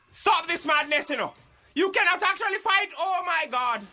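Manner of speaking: loud, high pleading; alarmed shout. Acted. Host pleading with the fighting guests, alarmed shout. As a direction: stop them, urgently.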